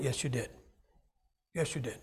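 low, soft insistence; gently insist, dropping the voice right down; quiet contradiction. Acted. Preacher answering a doubter's denial, low soft insistence. Softly rebuts his imagined objector.

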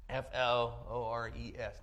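slow, clear spelling of F L O R E S; spelled out. Shown as a demonstration. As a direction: spell F L O R E S out slowly, letter by letter. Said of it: "Not Flowers, but Flores".

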